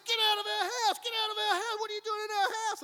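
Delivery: very high, loud yelled order; yelling them off. Retold or imitated. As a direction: drive them off the property. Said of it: "He goes".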